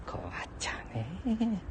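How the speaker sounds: breathy whispered aside. Real, not acted. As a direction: whisper it to yourself. Aside after laugh.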